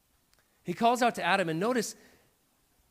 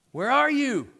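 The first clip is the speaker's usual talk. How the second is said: raised calling voice, a little higher; God calling out; 6 semitones higher, 5 dB louder than usual. Acted. God calls out to the hiding man, raised voice. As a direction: call out into the garden.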